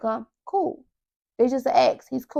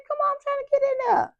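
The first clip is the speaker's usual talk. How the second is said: much higher, fast exasperated plea; pleading whine; 13 semitones higher, 6 dB quieter, faster than usual. Retold or imitated. Herself begging God to explain, high and exasperated. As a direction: beg quickly in a high pleading voice.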